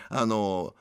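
ordinary delivery

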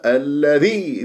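normal talking voice

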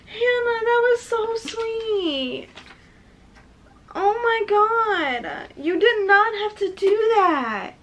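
high, slow, tearful whine; very emotional. Real, not acted. Telling a friend the gift was too sweet, very emotional. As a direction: draw the words out, choked up with gratitude. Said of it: "I'm very emotional right now".

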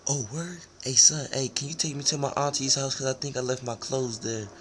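high, fast, soft put-on voice. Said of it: "got back into my voice".

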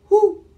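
high, loud short hoot; owl hoot. A narrator's reading. Owl in the story, hooting.

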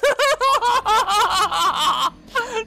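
loud, high-pitched laughing fit; long helpless cackle, high and loud; helpless laughter. Real, not acted. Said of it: "I don't know why I'm laughing".

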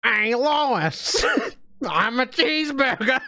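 goofy call to Lois, laughing into it; Peter Griffin impression. Streamer imitating Peter Griffin, goofy and high. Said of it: "Peter Griffin".

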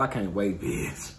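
normal talking voice

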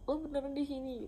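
high-pitched imitated disbelief; retold yell of disbelief. Friend finding her at church, mock surprised yell. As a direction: mimic a friend's startled question. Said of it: "dia teriak kayak".

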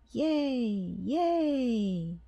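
high excited cheer. A narrator's reading. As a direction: shout yay like a happy crowd. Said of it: "Everyone cheered".

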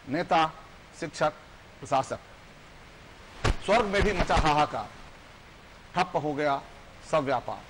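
emphatic verse in short, separated phrases; poem recitation. Acted. Guest recites how society reacted, emphatic poetic recitation. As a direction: declaim each item, pausing after it.

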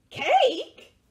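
gasp, then high loud exclamation; surprised mouse. Acted. Mouse learning it is cake for dinner, high surprise. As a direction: gasp and squeal the word.

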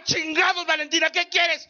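exasperated dad shout. Retold or imitated. Dad snapping at daughter.

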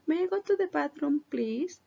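bright high teacher model, rising on please; model sentence. Shown as a demonstration. Teacher modelling the phrase high and clear for pupils. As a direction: sing it out high for the class. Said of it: "Decirme bien esa frase".

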